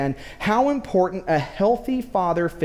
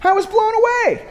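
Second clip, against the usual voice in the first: very high, loud astonished cry; hammed-up amazement; 13 semitones higher, 5 dB louder than usual. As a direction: exclaim in comic disbelief, pitch way up. Amazed punchline of his story.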